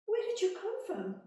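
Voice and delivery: high, loud, startled question; queen shocked to see the little man. Acted. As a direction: gasp it out in surprise.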